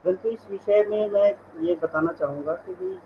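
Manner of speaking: usual speaking voice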